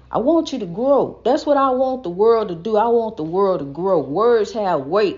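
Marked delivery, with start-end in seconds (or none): none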